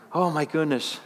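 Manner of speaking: normal talking voice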